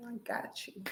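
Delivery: a hushed I got you; whispered aside. Retold or imitated. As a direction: whisper it to him on the side. Quietly reassuring the worker aside.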